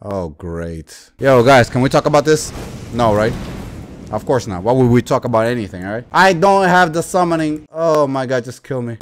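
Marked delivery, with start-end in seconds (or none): none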